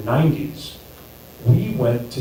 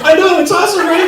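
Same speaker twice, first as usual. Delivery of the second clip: gleeful; 5 dB louder than usual. Retold or imitated. Gleeful reply to girlfriend.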